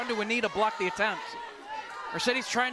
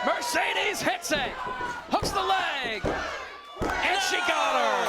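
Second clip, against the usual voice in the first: rising play-by-play call; excited; 7 semitones higher, slower than usual. Real, not acted. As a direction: call the finish with rising energy. Play-by-play climax of match.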